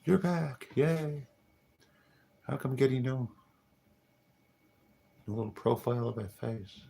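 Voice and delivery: excited greeting. Real, not acted. Greeting returning viewers, high and excited. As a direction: cheer them back.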